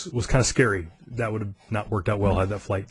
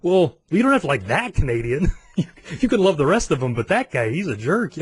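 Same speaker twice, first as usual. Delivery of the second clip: higher-pitched, laughing mock protest; joking comeback; 5 semitones higher than usual. Traveler joking back at customs guy, laughing. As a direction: argue it playfully through a laugh.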